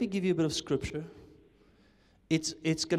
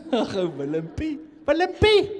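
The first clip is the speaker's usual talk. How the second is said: higher, laughing mock sneer; sneering enemy; 8 semitones higher, 8 dB louder than usual. Enemy mocks weak Willempie, sneering laughing voice. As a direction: cackle like the dismissive enemy.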